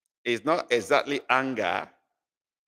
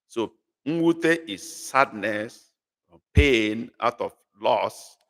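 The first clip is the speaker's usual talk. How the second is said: slow teaching definition; slower than usual. Shown as a demonstration. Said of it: "teacher defines the word".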